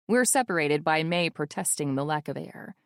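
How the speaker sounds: ordinary delivery, nothing marked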